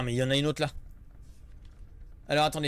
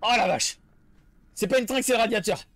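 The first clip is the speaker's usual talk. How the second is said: ordinary delivery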